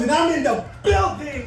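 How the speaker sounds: shouted high to hype the room. Real, not acted. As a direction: call it out to the crowd, pumped. Hyping up the crowd.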